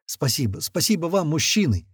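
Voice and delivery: ordinary delivery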